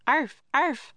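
high little puppy barks; dog bark. A narrator's reading. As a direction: yap like a small dog. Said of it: "barked Teddy".

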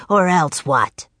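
sinister villain's challenge; challenging Raven King. A narrator's reading. Raven King challenges the children, scornful. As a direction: throw the threat back scornfully.

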